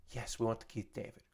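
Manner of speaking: low, very soft mock-timid answer; meek hushed reply. Client confirming to the star voice actor, meek and hushed. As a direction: answer small and under the breath.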